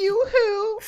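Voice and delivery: very high sing-song "yoo-hoo"; mock girly call. Teasing call as medic girlfriend.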